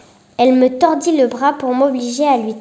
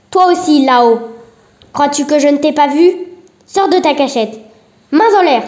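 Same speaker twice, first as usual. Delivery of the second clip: armed threat; 3 semitones higher, 5 dB louder than usual. Acted. Marie spots the hidden detective and orders him out, shouting. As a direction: call out the hidden man at gunpoint. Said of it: "elle ajouta".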